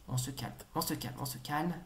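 soft, low murmur; self-calming. Real, not acted. Player tells himself to settle, self-calming. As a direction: hush yourself, low and soft.

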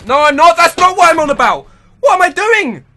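high loud exasperated shouts; frustrated yell. Real, not acted. Gamer stuck in a fence, yelling at himself in frustration. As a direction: yell at yourself in disbelief.

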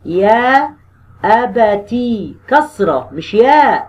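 very slow, drawn-out spelling of أبتي; slow dictation. Shown as a demonstration. Teacher slowly showing how أبتي is written. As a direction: spell it out slowly as if writing on the board. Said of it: "بتتكتب كده".

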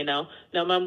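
ordinary delivery

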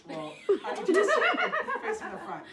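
breaking into laughter mid-sentence; can't keep a straight face; laughing. Real, not acted.

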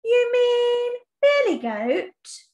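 high, slow worried question; fearful question. Shown as a demonstration. Teacher demonstrates animals' frightened question, high and slow. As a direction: sound scared asking about the goat. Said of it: "they say".

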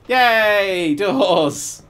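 loud, high, drawn-out hooray; big cheer. Pretend. Player celebrating finished doors, loud high cheer. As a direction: cheer it out at the top of the voice.

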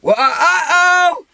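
high, loud, drawn-out whoop; shouted call. Pretend. Hider shouting the prompted call, loud whoop. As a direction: holler it long and high.

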